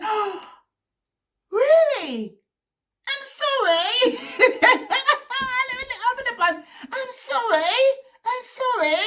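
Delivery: high, laughing, drawn-out sorry; giggling mortified sorry; embarrassed laughing apology. Retold or imitated. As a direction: laugh through the apology in mock shock.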